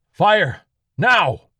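very high, loud shouted order; an officer yelling at his men to fire; barked command. Acted. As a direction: bark it at the troops.

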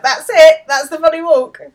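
imitated high, delighted outburst; excited exclamation. Retelling her spotting the funny walk, excitedly.

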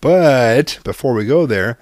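loud, slow, drawn-out "but"; emphatic comeback. Retold or imitated. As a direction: cut in loudly, stretching the first word. Retold comeback after apology.

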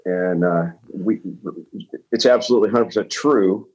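ordinary delivery

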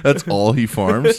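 slow question spoken through laughter; laughing. Real, not acted. Amused by the celery farmer joke, asked slowly while laughing. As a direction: laugh your way through the words.

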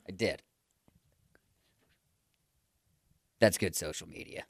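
very soft, low admission; hushed and low, savouring it; quiet confession. Acted. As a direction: confess it low, almost under your breath. Confesses signing up during intervention.

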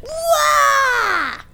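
high, drawn-out yelp; startled wail. Retold or imitated. Dad hit by falling cake, startled wail. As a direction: cry out as if jumping up. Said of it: "jumped up and went".

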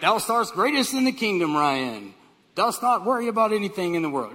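loud, grand King James delivery; God. Acted. Heavenly voice addressing the preacher, pompously booming. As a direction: proclaim it in mock-archaic majesty. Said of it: "he speaks in King James Version".

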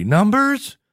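very high cracking Numbers?; Peter Brady voice crack. Retold or imitated. Host imitating Peter Brady's voice breaking on Numbers?, squeaking. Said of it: "Total Peter Brady".

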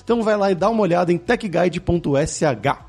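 lively, projected host sign-off and sponsor plug; podcast ad read. Acted. Podcast host plugs sponsor courses to listeners, upbeat ad read. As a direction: sell it with punchy, swooping announcer energy.

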